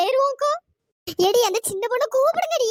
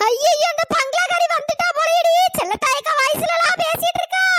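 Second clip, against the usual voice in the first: frightened cry; 6 semitones higher, 5 dB louder than usual. Acted. Thinks the ghost has come.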